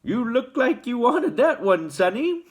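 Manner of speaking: friendly shopkeeper with a laugh; pleasant shopkeeper. A narrator's reading. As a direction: chuckle it out kindly. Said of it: "the shopkeeper said pleasantly".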